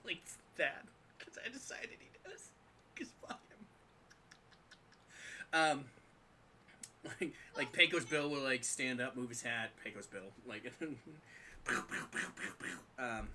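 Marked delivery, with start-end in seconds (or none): 1.2-3.7 soft unvoiced snark; whispered spite; whispered aside. Real, not acted. Snide aside. 11.7-12.5 pew pew. Acted. Gun noises.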